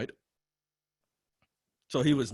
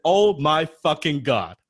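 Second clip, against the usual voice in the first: slow, louder, drawn-out exclamation; excited yell; 5 semitones higher, 7 dB louder, slower than usual. Retold or imitated. Fan meets hero at a workshop, slow excited yell. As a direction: stretch it out in stunned delight. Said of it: "I probably said that like ten times".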